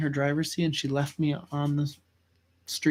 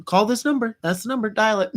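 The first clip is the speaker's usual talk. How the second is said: higher, louder dare in short commands; imitated insistent mom; 7 semitones higher, 4 dB louder than usual. Mom calls her child's bluff with the phone, insistent. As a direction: push the phone on them, mock-stern. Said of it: "She'd pull up on her phone".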